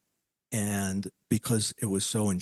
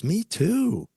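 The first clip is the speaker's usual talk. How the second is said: playful quip; 12 semitones higher, 5 dB louder than usual. Retold or imitated. Medium's quip, quoted.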